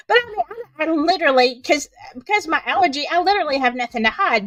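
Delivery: ordinary talk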